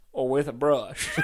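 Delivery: put-on Texas accent. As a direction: tack it on in mock Texan. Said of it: "Can you do a Texas accent?".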